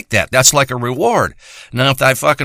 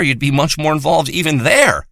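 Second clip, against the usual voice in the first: ordinary talk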